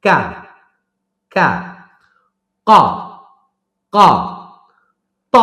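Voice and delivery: কা, ক, ত each held and sharply released; isolated syllables, every stop popped hard; hard plosives. Shown as a demonstration. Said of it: "একটু ধরে ছেড়ে দেওয়া". (শক্ত করে ধরে ছেড়ে উচ্চারণ)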